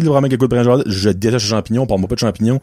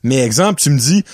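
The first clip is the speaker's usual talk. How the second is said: ordinary talk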